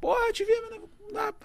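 high-pitched excited fan. Retold or imitated. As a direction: gush like a grateful fan. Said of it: "a galera".

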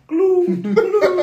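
high, slow mock goose call; high goose-call squeal.

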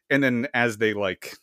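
normal talking voice

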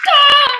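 very high, loud mock scream; play scream. Podcaster under pretend gunfire, loud shriek.

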